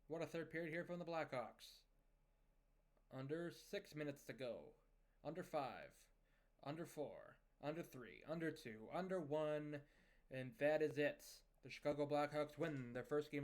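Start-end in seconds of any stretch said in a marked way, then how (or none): none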